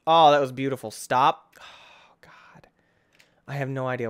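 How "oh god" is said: breathless whispered oh God; hushed dread. Real, not acted. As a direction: barely voice it. Whispered after jump.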